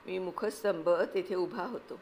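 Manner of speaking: ordinary delivery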